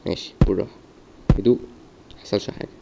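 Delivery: breathy whisper to himself. Real, not acted. Gamer whispering to calm himself. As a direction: hush it under your breath. Calming himself during play.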